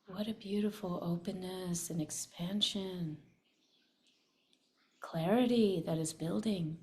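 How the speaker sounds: calm, soothing meditation voice; guided meditation. Acted. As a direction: guide the group gently into release. Leads a meditation.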